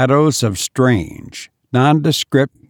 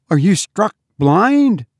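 high, exasperated mistress; demanding; 8 semitones higher than usual. A narrator's reading. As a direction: scold with a sharp question. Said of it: "demanded her mistress".

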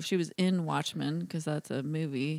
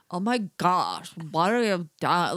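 put-on ridiculous Rich Dicks accent. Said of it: "ridiculous accent where they're like".

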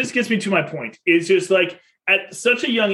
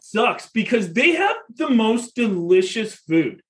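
slow, higher, lightly voiced sulky kid; disappointed kid; 5 semitones higher, slower than usual. Dad voicing his son lamenting the pizza place, slow higher kid voice. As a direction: let it sag like a let-down little boy.

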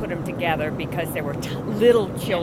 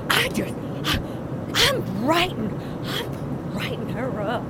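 hammed-up indignant complaint; mock indignation; 5 semitones higher than usual. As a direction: draw out the outrage, pitched way up.

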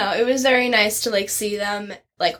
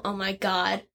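ordinary talk

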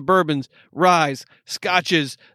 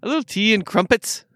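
higher-pitched, lilting put-on British accent; mock British accent; 5 semitones higher than usual. Would-be islander wanting tea, mock British accent. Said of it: "say it like we do on the island".

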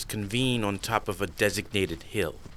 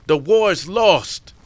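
higher, louder scornful shout; angry; 6 semitones higher, 6 dB louder than usual. Acted. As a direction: snap at him in contempt. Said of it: "Way of Earth says".

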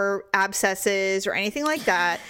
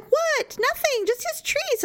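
much higher, mock-innocent husband voice; husband's innocent reply; slower than usual. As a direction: play the clueless husband, high and light. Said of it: "And he's like".

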